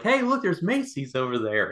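mock-innocent diversion; fake casual distraction. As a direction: point something out too innocently. Acting out a distraction ploy.

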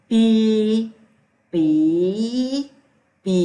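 ปี read out loud and clear, teacher's model; loud tone drill. Shown as a demonstration. Teacher models ปี so students can repeat it. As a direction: call out ปี for the class to copy. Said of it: "ปออี".

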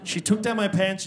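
usual speaking voice

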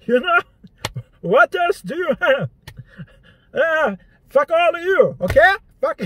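loud, high mock dad, slow on the punchline; imitating dad annoyed nobody laughs; dad's punchline. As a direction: repeat the punchline then scold the crowd. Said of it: "He might say something like".

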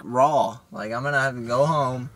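ordinary talk

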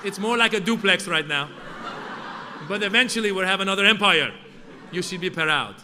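put-on loud, proud Persian-accented lecture; proud dad, Persian accent. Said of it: "And my dad would say".

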